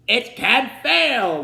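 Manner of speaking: slow, loud, high royal shout; king shouting. A narrator's reading. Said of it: "the king shouted".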